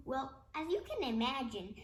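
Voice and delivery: ordinary delivery, nothing marked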